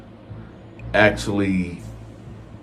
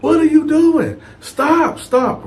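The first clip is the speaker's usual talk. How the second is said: high panicked screaming at him; retold scream; 6 dB louder than usual. Wife screams at husband smashing the glass, shrieking. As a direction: shriek at him to stop, pitch way up and loud. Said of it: "And she screamed like".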